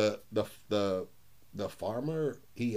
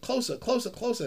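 high, coaxing call to come closer; coaxing grandpa; 11 semitones higher than usual. Retold or imitated. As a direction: lure the boy in, teasing. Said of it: "He's like".

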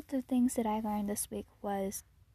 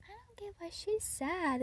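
put-on higher whispered child; whispered sassy girl; 8 semitones higher, 3 dB quieter than usual. Girl mocks a sad classmate, high whisper. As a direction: whisper it like a catty kid. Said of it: "She's like".

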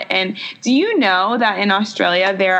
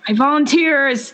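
Hunger Games volunteer; 5 semitones higher than usual. Pretend. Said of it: "run after him and be like".

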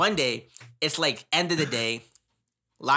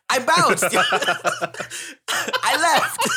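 words bursting out through high laughter; laughing; 11 semitones higher, 6 dB louder, much slower than usual. Real, not acted. Student recalls walking out on teacher, laughing loudly. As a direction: laugh while bragging, louder. Laughing retelling his exit.

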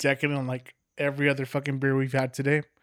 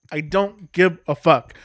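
louder, punching the word give; emphatic repeat; 4 semitones higher, 8 dB louder than usual. Real, not acted. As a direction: spit it out again, harder.

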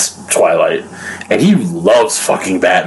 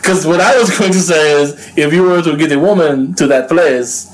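usual speaking voice

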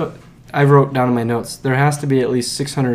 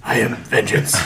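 gravelly Batman voice; 2 semitones lower than usual. Retold or imitated. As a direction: growl it like Batman. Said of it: "Batman gravelly voice".

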